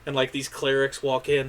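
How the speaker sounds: ordinary delivery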